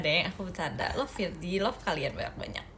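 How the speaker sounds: ordinary delivery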